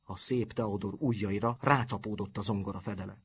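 plain reading voice

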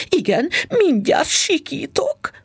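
high, slow, barely held-back panic; wife on the edge of screaming at the cheap fur, high and tense; near scream. A narrator's reading. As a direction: she is about to scream inside, pitched high and slow. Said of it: "mondta magában".

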